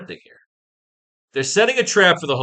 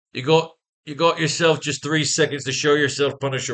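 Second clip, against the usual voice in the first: put-on a light Irish accent for a comic villain. Reading Jigsaw's threat to the Punisher aloud, in a light Irish accent. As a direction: read the threat with a hint of Irish. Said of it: "I don't know why he's Irish".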